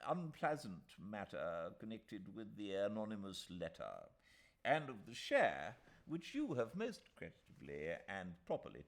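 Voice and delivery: put-on a pompous old solicitor reassuring; old lawyer.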